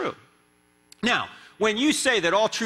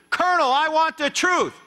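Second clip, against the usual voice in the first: higher and louder, shouting the demand at the witness; courtroom shout; 12 semitones higher, 5 dB louder than usual. Shown as a demonstration. Tom Cruise confronting the Colonel on the stand, shouting. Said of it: "Much better, much better".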